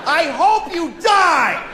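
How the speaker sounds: road rage shout. Retold or imitated. Road rage rant continued.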